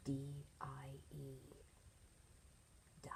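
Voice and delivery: slow whisper, letter by letter; hushed spelling. Shown as a demonstration. Teacher whispering a grim word to the class, hushed. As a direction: whisper each letter ominously. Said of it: "spelling grim word".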